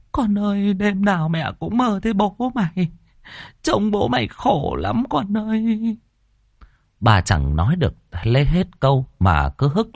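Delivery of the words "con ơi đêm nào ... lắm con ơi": tearful lament. Acted. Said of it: "Bà Trì lại òa lên khóc".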